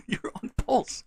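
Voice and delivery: high, slow, unvoiced laugh-whisper; breathy laughing disbelief. Real, not acted. Pleading with the machine, whispered through a laugh. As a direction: laugh it out under your breath.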